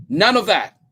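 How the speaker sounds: loud, high, curt rebuke; husband shutting down the question; sharp. A narrator's reading. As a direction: cut her off firmly. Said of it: "said Jim sharply".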